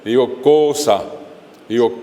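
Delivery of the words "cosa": cosa with a loaded, stretched CO; stress on first syllable. Shown as a demonstration. Teacher giving examples of llanas, stressed CO in cosa. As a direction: slowly punch the CO in cosa. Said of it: "Cargo la voz".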